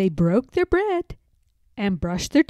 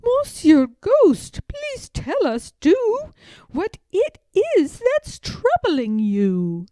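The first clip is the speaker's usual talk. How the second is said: put-on little girl Madeline; 9 semitones higher than usual. A narrator's reading. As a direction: gently ask the ghost to share. Madeline voiced in story.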